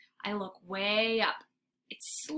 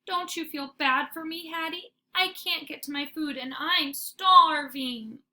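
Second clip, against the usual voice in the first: whining plea; 6 semitones higher, 4 dB louder than usual. Acted. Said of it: "I give her my best whine".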